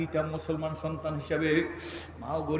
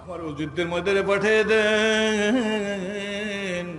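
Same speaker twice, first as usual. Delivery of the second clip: raised, rhythmic sermon delivery; melodic preaching; 3 semitones higher, 7 dB louder than usual. Acted. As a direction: preach it in swelling, sung-out phrases. Preaches with rising melodic force.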